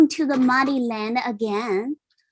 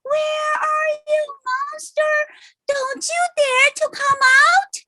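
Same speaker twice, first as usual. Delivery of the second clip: very high, thin, wailing echo of the shout; the mountain echoes the monster's scream back. A narrator's reading. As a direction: cry it out like a far-off mountain echo. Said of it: "echoed with the same screaming".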